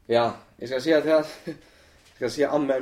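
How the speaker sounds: usual speaking voice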